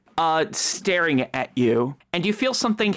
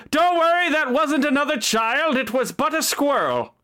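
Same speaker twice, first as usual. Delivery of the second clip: coachman calling out; 9 semitones higher than usual. Acted. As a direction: yell down from the coach box. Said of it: "you hear from outside".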